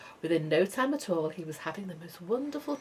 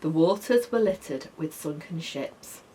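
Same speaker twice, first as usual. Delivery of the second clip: usual speaking voice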